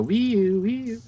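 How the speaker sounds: high two-note siren noise; mock siren wail. Player mimics a siren for the spider ride, high wailing wee-oo. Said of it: "on her spider and go".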